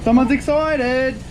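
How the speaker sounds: high, stretched-out happy cheer; real jubilation. Real, not acted. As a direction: crow to an excited dog, slow and high. Celebrates the running car with dog.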